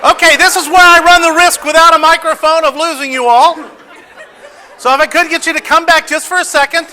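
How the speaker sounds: loud, high, laughing through the words. Real, not acted. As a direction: laugh while calling them back. Audience all doing cartoon voices.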